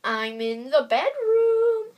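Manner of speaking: slow, high wobbly wolf-as-grandma voice; wolf faking a frail old grandma; strange wobbly voice. Acted. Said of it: "in a strange, wobbly voice".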